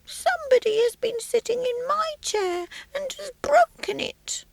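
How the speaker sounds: a tiny bear squeaking, hushed and breathy; whispery high baby bear; small squeaky baby voice. Acted. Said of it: "in a small, squeaky baby voice".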